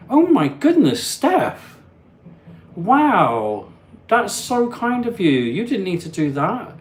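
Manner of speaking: high, loud, astonished gasp of thanks; amazed and touched, pitch soaring; touched surprise. Real, not acted. Streamer stunned by a viewer's kindness, touched surprise.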